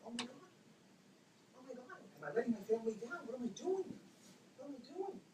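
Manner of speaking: high, hushed retold inner panic; distressed thinking aloud; anguished self-questioning.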